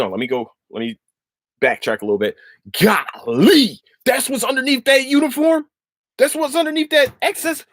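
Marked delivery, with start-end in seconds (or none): 2.8-7.6 high, giddy gasp of admiration; swooning fan, pitched way up; starstruck gushing. Real, not acted. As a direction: gush like you just saw your crush. Gushing over a celebrity crush.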